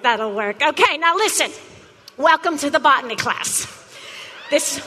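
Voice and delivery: ordinary talk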